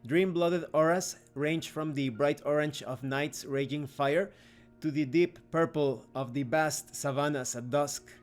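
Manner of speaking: ordinary delivery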